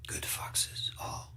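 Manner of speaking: very soft whisper, fading out; whispered farewell. A narrator's reading. Fox honoring dead friends, in a whisper. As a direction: let it fall away in a hush.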